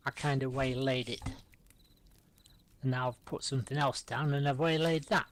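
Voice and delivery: ordinary talk, nothing marked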